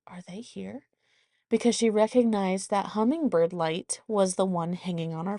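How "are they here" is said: hushed question. Retold or imitated. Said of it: "she goes".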